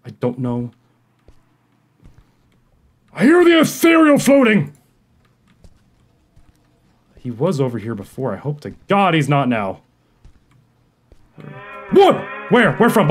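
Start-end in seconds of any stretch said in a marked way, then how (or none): 3.1-4.8 much higher, louder alarmed shout; panicked cry. Real, not acted. Gamer hearing the monster nearby, panicked cry. 11.9-13.1 startled yelp. Real, not acted. Startled by monster.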